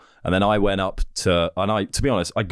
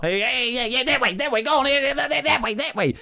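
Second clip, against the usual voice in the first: high, excited coach urging a player on; coach shouting instructions. Retold or imitated. Hockey coach urging a player down the wing. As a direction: egg the player on like a frantic coach. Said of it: "that's him giving instructions".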